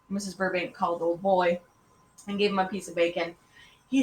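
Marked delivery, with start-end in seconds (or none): none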